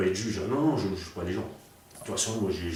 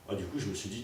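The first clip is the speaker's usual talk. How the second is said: normal talking voice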